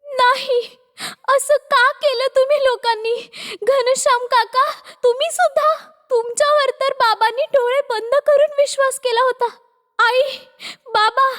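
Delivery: high-pitched, tearful protest; wailing, grief-stricken daughter; anguished crying. Acted. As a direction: cry out in grief and betrayal. Learns her parents were killed.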